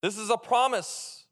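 normal talking voice

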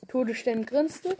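ordinary delivery

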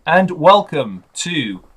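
peaking, distorted loud mic; clipping distorted mic. Real, not acted. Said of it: "my audio is peaking".

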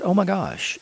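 high, sudden gasp of recognition; a light mock exclamation; retold surprise. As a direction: jump up in pitch, startled.

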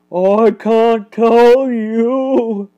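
too scared to speak; terrified. Acted. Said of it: "Hawkins was terrified".

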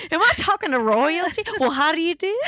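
high, hammed-up Southern belle; Southern accent. Mocking her date as royalty, fawning Southern accent. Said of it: "In, like, a Southern accent".

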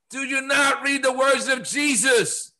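high, slow, emphatic challenge; exasperated retort. Acted. Pastor challenging those who preach he's coming, high exasperated retort. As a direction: stretch it out in exasperated disbelief.

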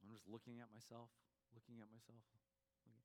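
low and hushed, fading to nothing; self-absorbed murmur. Acted. Preacher acting out someone looking only at themselves, murmuring. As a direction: repeat it inwardly, dying away to a whisper.

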